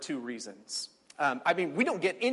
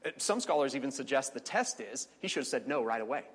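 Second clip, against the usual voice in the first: ordinary delivery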